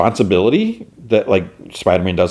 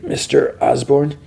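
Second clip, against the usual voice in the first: groggy question; 6 semitones higher, slower than usual. Retold or imitated. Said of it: "He's like".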